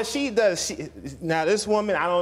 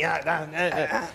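mocking his wife; 3 semitones lower than usual. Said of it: "You see how she mocks me?".

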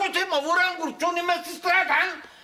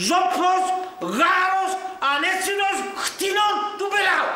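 angry outburst. Acted. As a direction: hurl the names at him.

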